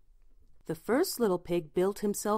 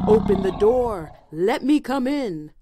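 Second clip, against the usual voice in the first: loud, commanding wolf voice; roaring wolf; 3 semitones higher, 6 dB louder than usual. A narrator's reading. Wolf at the house of sticks, roaring to be let in. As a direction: roar the order as the big bad wolf. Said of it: "the wolf roared".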